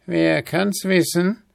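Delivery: hohe, listige Altweiberstimme; alte Frau. A narrator's reading. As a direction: verschmitzt nachfragen. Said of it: "versetzte die Alte".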